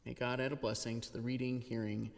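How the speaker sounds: normal talking voice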